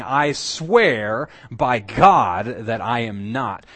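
loud, drawn-out, emphatic swearing; vehement oath. Acted. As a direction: swear it slowly and forcefully. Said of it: "it's a strong term that involved pronouncing death".

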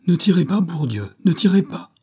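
high, loud panicked plea; man trapped, pleading in terror, loud and high; terrified cry. Acted. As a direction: beg them not to shoot. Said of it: "un cri de terreur retentit".

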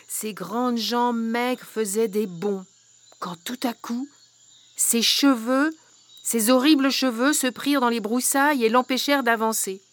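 ordinary delivery, nothing marked

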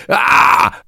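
breathless unvoiced loud cry of horror; desperate anguished shriek; half-mad scream. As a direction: let out a frantic raw scream. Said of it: "üvöltöttem félőrülten".

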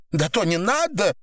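high pleading cry without much volume. A narrator's reading. Woman begs torturer to stop, high pleading cry. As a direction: beg him to stop in a high voice. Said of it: "закричала Наташа".

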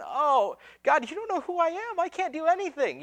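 high, whiny mock protest; imitated pleading Moses. Moses protesting God's call, mock pleading. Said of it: "Moses is going".

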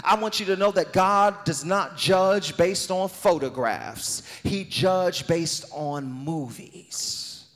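loud, high, sung preaching over music; full-force preaching. Acted. Pastor preaching full force to the congregation, loud and high. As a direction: whoop it to the crowd.

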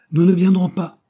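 loud, high, decisive refusal; forceful interruption; interrupting. A narrator's reading. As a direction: cut in and refuse flatly. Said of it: "interrompit Dobbs".

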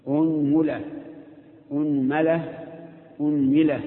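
أنملة said as unmula, unmalah, unmila; أنملة three times, changing only the meem vowel. Shown as a demonstration. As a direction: cycle the meem through u, a, i with u at the start. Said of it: "ضم الهمزة وثلث في الميم".

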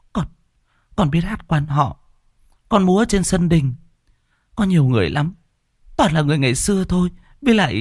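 put-on high girl's voice, dazed and scared; dazed and trembling. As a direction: recount it like a frightened sleepwalker. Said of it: "đờ đẫn run rẩy".

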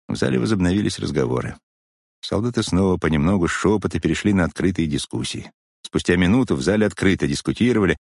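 ordinary delivery, nothing marked

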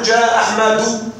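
slow example. Shown as a demonstration. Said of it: "كما نقول".

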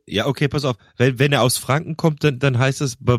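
usual speaking voice

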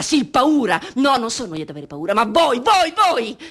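building to a high, loud accusing yell; accusing shout. Acted. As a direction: point at them and shout. (accusa crescente, gridata)

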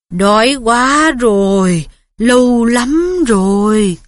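slow, cold, hungry monster voice; cold bloodthirsty voice. A narrator's reading. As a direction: drag it out like a starving beast.

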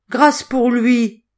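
high, slow, loud begging; desperate plea. A narrator's reading. Boy at his master's feet, desperate plea. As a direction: beg for mercy, drawing it out. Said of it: "en se jetant aux pieds".

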